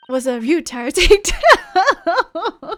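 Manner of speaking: loud laugh through Ryutaro takedown; laughing correction. Shown as a demonstration. Streamer corrects the name with a loud laugh. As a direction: blurt it out laughing and slow. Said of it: "Not quite".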